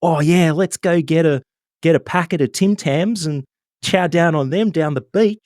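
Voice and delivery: high, hammed-up Aussie bloke voice; mock Aussie nationalist. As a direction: lampoon the beer-and-beach Australian cliché. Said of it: "the nationalist approach to things like".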